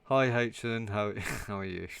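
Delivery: greeting breaks into giggles; can't keep a straight face; laughing. Real, not acted. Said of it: "should we take a step back and start that one again".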